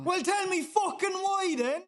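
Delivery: high, exasperated yell at her sister; angry shout. Acted. Sister yelling back in a quarrel, angry. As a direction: snap it back, voice cracking up high.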